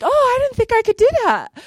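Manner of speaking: high, loud squeal of delighted disbelief; thrilled she managed the ride; amazed at herself. Retold or imitated. Proud after her first long ride, high, thrilled disbelief. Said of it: "I was like".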